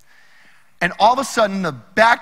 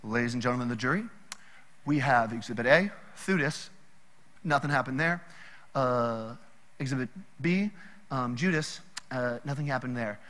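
low, soft, fast offhand lawyer voice; mock lawyer address; 7 semitones lower, 9 dB quieter than usual. Gamaliel addressing the Sanhedrin, low quick mock-lawyer summary. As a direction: brush the case aside like a smooth lawyer. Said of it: "He's like".

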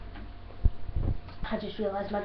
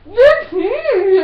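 laughing shout; 8 semitones higher, 10 dB louder, much slower than usual. Real, not acted. Mouth-full shouted quarrel.

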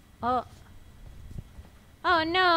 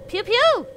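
pew pew gun sounds; 6 semitones higher, 5 dB louder than usual. Pretend. Gun noise imitation.

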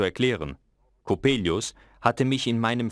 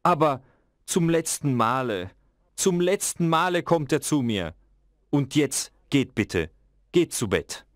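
resolved father; 6 semitones higher than usual. A narrator's reading. Father resolves, sends children away.

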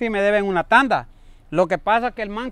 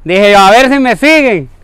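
loud, higher, overly cheerful cover-up; loud bluffing greeting; 6 semitones higher, 15 dB louder than usual. Acted. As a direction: shout cheerfully as if it were all a joke. Caught debtor bluffing loudly.

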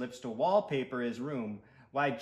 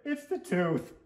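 put-on whiny little boy; high boy voice; 5 semitones higher, 4 dB louder than usual. Stuart voice.

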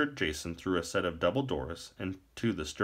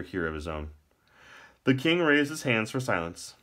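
plain reading voice

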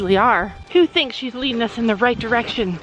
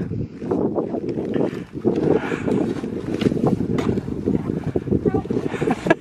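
breathless laughter; 6 semitones higher, 4 dB quieter than usual. Real, not acted. Laughs at the horses' crossing.

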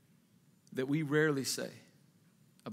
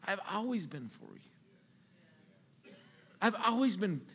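gentle voice of God; 8 dB quieter than usual. Retold or imitated. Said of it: "He's like".

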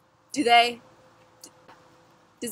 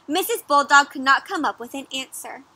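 ordinary delivery, nothing marked

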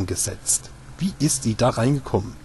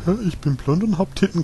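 mock bimbo quote; 7 semitones higher than usual. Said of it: "dieser typische ... Charakter".

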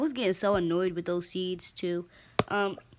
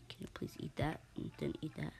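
whispering; 3 semitones lower, 12 dB quieter, faster than usual. Real, not acted. Whispering to game characters.

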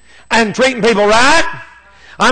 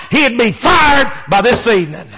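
normal talking voice

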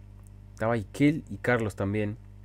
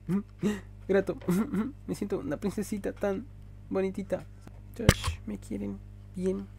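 cutesy princess voice; 10 semitones higher than usual. Pretend. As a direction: coo like a pampered princess. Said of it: "ya voy a parar de frescura".

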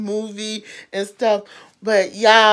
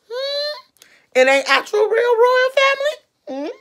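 sassy retort; 14 semitones higher than usual. Pretend.

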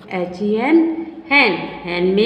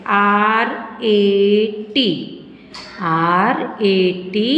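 slow spelling; 2 semitones lower, much slower than usual. Shown as a demonstration. Teacher spells word slowly.

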